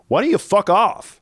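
high, loud mock snap. Colleague telling the fussing boss to back off, in a mock snap. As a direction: fling it back, suddenly fed up. Colleague snaps, dropping the accent.

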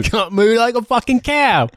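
high, hammed-up Aussie yob voice; a jeering Australian-accent caricature; mock Australian bigot. Joining mock Australian rant.

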